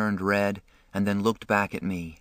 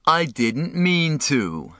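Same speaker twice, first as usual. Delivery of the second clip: high, stretched-out angry echo; furious, raised-voice mimicry; mocking shout; 6 semitones higher, 3 dB louder, slower than usual. A narrator's reading. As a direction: throw the words back loudly, drawn out. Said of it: "Derry shouted".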